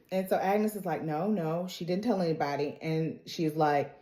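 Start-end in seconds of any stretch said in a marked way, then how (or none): 1.0-2.8 much softer, hushed denial; quietly calm her friend; soft reassurance. Retold or imitated. Agnes reassuring, voiced in retelling.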